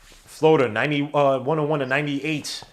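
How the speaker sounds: sports announcer calling the game; live play-by-play. Acted. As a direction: call it like a broadcast announcer.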